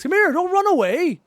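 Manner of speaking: high, loud playful shout; calling after someone. Pretend. Chasing an enemy in game, loud calling. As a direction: holler after a fleeing foe.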